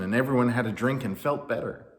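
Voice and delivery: ordinary delivery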